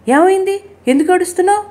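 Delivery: alarmed. A narrator's reading. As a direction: ask in rising panic, voice pitched right up. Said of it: "కంగారు వచ్చింది". (కంగారుగా, గొంతు పెంచి)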